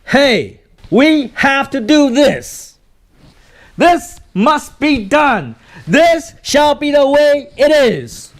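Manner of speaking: slow, high, loud proclamation; loud, drawn-out rallying command; commanding shout. Shown as a demonstration. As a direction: declare it slowly at full voice. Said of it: "then they're looking at you again".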